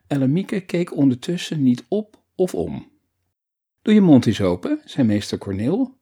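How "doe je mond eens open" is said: louder, bossy order; a bit commanding. A narrator's reading. Teacher tells the girl to open her mouth, a bit commanding. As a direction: give a firm little order. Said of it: "een beetje bevelend".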